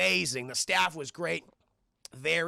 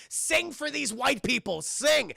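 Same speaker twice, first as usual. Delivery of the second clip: raised, loud, slow command; bossy restaurant owner; 6 semitones higher, 4 dB louder, slower than usual. Retold or imitated. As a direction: order your workers to perform. Boss orders his workers to sing.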